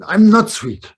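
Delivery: high, loud retold protest; angry grandchild. Grandchild rejects grandparent's sweet nickname, mock-angry outburst. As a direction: imitate a cross little child's outburst. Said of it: "some kind of anger".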